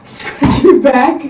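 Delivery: slow, high, incredulous question; surprised. Real, not acted. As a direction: repeat it in disbelief. Surprised at brother's request.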